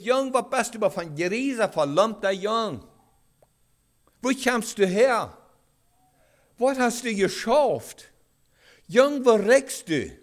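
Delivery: loud, high, drawn-out telling-off; acted scolding. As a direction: play an outraged father dressing down his boy. Imagined father scolding the returned son.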